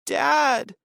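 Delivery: put-on a boy wailing for his father; crying out Dad. Tommy crying out as his father is stabbed. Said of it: "Tommy cried".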